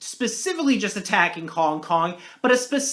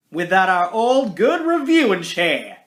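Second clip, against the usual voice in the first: put-on southern accent; 3 dB louder than usual. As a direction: slip into a playful Southern twang. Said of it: "I went southern there for a second".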